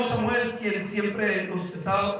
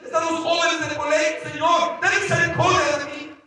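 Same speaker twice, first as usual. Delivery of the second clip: desperate plea; 7 semitones higher, 3 dB louder than usual. Retold or imitated. Retold desperate prayer inside the whirlpool.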